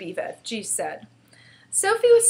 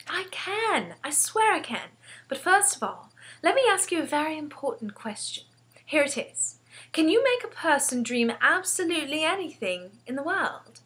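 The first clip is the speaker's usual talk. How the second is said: put-on high-pitched, eager girl; insistent girl with a plan; earnest young Sophie; 7 semitones higher than usual. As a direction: press the question as a keen child.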